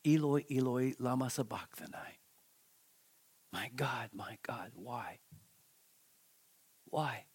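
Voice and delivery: low, hushed cry fading to near whisper; Jesus forsaken. Acted. Preacher voices Jesus on the cross, in hushed forsaken grief. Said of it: "he would utter".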